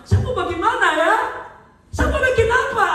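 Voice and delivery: high, loud put-on wail of questions; mock helpless complaint. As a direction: play someone loudly at a loss. Acted helpless complaint facing problems.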